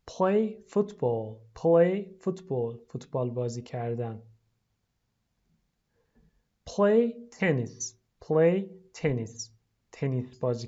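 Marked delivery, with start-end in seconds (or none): 0.1-2.8 slow model. Shown as a demonstration. Teacher models for pupils, slow. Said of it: "لطفاً تکرار کنید". 6.7-9.5 slow model. Shown as a demonstration. Teacher models for pupils, slow. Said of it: "لطفاً تکرار کنید".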